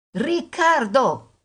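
high, drawn-out call down the hall. A narrator's reading. Mother calling son from kitchen door, calling out. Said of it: "Roberta gridò affacciandosi".

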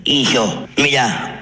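normal talking voice

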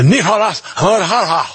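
put-on rolled, Spanish-accented Nicolás Maduro. Host mocking how media say Latin names, in a Spanish accent. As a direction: say the name like a Spanish newsreader. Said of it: "I have to do that in a Spanish accent".

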